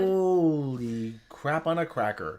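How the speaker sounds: slow, drawn-out disbelief; real slow-motion astonishment; astonished. Real, not acted. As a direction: drag the exclamation out in amazement. Amazed at the subscriber count.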